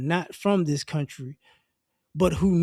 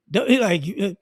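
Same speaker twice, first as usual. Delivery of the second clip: ordinary talk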